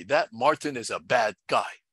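ordinary delivery, nothing marked

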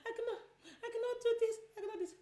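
high, laughing fake sob; overwhelmed mom fake-crying she cannot cope; mock crying complaint. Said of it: "And you're there complaining".

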